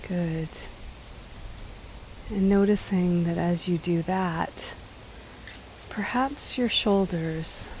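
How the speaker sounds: calm, unhurried body-scan voice with long pauses; slightly softened guiding voice, leaving space between phrases; guided meditation. Acted. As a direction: gently lead them into letting go, phrase by phrase. Leads a seated body scan.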